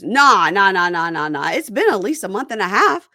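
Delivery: imitating a cast member brushing it off; quoted denial. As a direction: rattle off the nahs and rise. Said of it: "and he goes".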